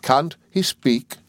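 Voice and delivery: high, slow, exasperated question; impatient. A narrator's reading. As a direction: demand it with drawn-out impatience. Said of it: "cried my aunt impatiently".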